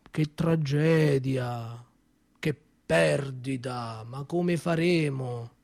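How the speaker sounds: slow, hushed, mock-mournful boss; mock condolences. Son mimicking the boss's condolences to his mother, slow and hushed. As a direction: lay on the fake sorrow, drawing each word out softly. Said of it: "le ha detto: Signora".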